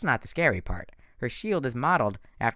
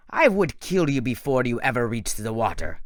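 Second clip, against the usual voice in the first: cold threat in a lightly voiced monster; a hint of a menacing villain. Doctor Thorne threatening Percy, lightly voiced menace. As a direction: make the threat calm and certain.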